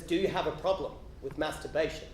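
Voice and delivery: low, hushed repeat of the question; close in the ear. Retold or imitated. Church lady asking the boy at his ear, low and soft. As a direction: confide it softly, pitched low. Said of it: "leans in close to my ear and says".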